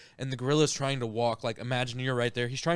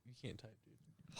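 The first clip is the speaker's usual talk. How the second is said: whispering; 25 dB quieter, slower than usual. Real, not acted. Teasing whisper about typing.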